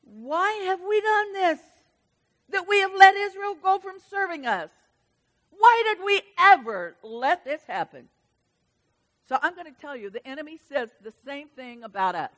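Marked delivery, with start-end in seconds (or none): 0.0-8.1 Pharaoh's dismay. Acted. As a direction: act out Pharaoh regretting letting Israel go. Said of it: "And he said".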